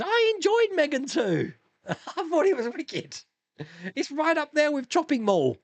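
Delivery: high-pitched, gleeful outburst; high, indignant but amused defence; playful protest. Real, not acted. Playful protest against a viewer's verdict.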